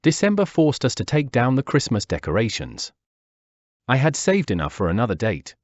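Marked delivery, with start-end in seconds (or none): none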